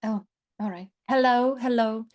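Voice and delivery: usual speaking voice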